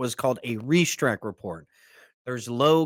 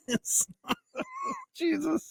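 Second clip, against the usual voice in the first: breaking up laughing, much higher; slower than usual. Real, not acted. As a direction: laugh out the words. Laughing at joke about men.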